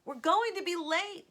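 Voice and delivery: high, loud call from another room. A narrator's reading. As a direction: call up to her son. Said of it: "Casey's mother called".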